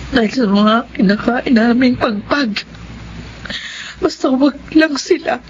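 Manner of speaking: earnest prayer to God; night prayer. Acted. Mother prays alone at night.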